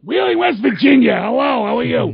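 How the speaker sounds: a hint of an old radio interviewer greeting a caller; Larry King. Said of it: "I used to wanna be sounding like Larry King".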